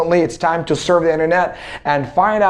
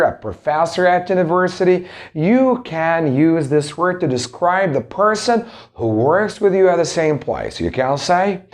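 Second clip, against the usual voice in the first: ordinary delivery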